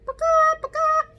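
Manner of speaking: very high, loud hen call on paka; high clucking hen noise. Shown as a demonstration. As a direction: squawk it like a hen. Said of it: "We had a hen".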